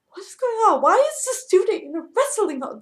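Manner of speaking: high, loud startled question; alarmed disbelief. Retold or imitated. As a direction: blurt it out in shock. Teacher sees student in wrestling hold.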